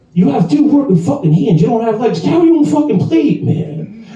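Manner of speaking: high, exasperated mock telling-off; mock scolding. Bartender scolds customer about the plate, high mock exasperation. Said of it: "I'm like".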